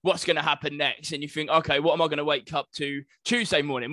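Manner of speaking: ordinary delivery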